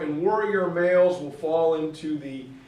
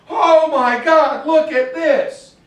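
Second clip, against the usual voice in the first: loud, high, slow mock amazement; amazed exclamation; 8 semitones higher, 10 dB louder, slower than usual. Migrants seeing the river valley, amazed exclamation. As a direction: draw it out in hammed-up awe. Migrants voiced, reaching green Pakistan.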